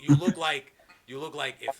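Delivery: normal talking voice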